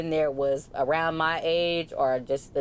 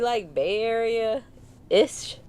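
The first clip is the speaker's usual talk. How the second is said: drawn-out, tentative "ish"; hedging question; 6 semitones higher, much slower than usual. Retold or imitated. Asking the Stockton coworker where he's really from, drawn-out hedging question. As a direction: stretch it out slowly, higher, as a doubtful suggestion.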